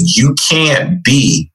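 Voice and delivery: drawn-out, weighty slow delivery; slow for impact. Retold or imitated. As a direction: say it slowly, pausing midway to let it land. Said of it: "slow because it was so powerful".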